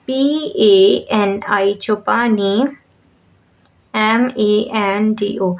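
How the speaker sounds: very slow, letter by letter; spelling aloud. Shown as a demonstration. Teacher spelling Chopani Mando for students, slow and letter by letter. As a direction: spell each letter out slowly for the class. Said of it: "चोपानी की spelling हो गई".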